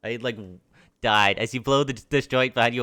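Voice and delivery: ordinary talk, nothing marked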